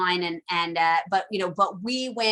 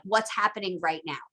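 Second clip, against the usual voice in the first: ordinary talk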